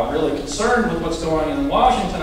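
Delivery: usual speaking voice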